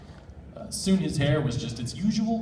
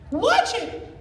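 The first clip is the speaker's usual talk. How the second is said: yelled; 4 dB louder than usual. Acted. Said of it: "she yelled".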